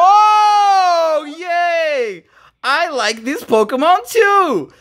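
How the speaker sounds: high, delighted squeal; excited. Real, not acted. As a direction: gush over the card. Pulls a liked card.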